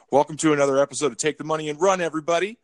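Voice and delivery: fast, upbeat show opener; podcast intro. Acted. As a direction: hype up the listeners.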